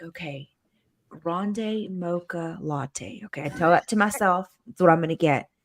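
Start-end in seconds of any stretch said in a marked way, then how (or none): 0.0-3.2 quiet rehearsing. Retold or imitated. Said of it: "I'm like, practicing".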